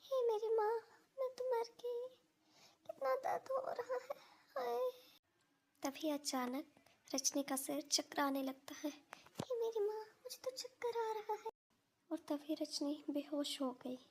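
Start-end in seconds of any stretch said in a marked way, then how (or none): 0.0-4.9 high, pained moaning cries; crying out in pain. 8.9-11.5 dizzy and faint.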